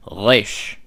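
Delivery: Resh with a throaty French R at the back. Shown as a demonstration. Teacher showing modern Israeli Resh. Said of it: "which is at the back".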